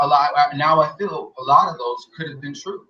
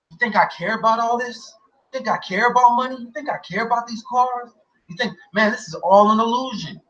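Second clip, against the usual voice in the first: higher, lightly voiced preachy guru; mock his lofty sermon, a bit higher; 8 semitones higher than usual. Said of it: "He would just be like".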